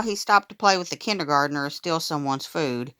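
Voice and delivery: usual speaking voice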